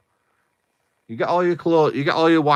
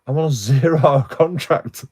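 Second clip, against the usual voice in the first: exasperated laugh; 5 semitones higher, 5 dB louder than usual. Retold or imitated. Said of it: "I'm like".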